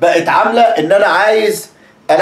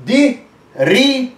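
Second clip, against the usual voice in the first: ري drawn out slowly and pitched up high; stress on ري; 6 semitones higher, much slower than usual. Shown as a demonstration. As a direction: lean hard and long on ري. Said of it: "دوست أهو ري".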